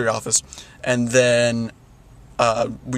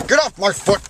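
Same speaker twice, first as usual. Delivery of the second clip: higher, sharp complaint; real sudden outburst; 6 semitones higher than usual. Real, not acted. To someone stepping on the foot, real sudden outburst. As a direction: snap at someone stepping on you.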